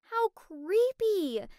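high, loud, drawn-out alarm; stretched-out, creeped-out exclamation. Acted. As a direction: react with exaggerated unease. Reacts to a creepy message.

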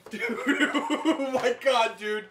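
slow, laughing disbelief; shocked laughter. Real, not acted. Laughing after a scary noise.

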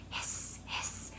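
long unvoiced hiss. Shown as a demonstration. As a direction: hiss like a snake. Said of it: "They go hiss".

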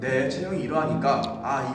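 ordinary talk

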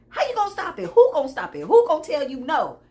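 high, loud rhetorical challenge; fired-up challenge. Real, not acted.

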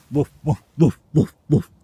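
loud, high playful woofs; barking like a dog. Pretend. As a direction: bark at the worms like a little dog. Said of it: "doesn't like dog".

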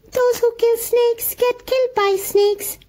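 high, mournful little-creature voice; sorrowful muskrat. A narrator's reading. Muskrat warning the mongoose, high and sorrowful. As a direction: sigh it out like a doomed small animal. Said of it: "more sorrowful than ever".

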